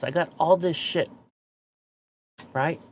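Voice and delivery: usual speaking voice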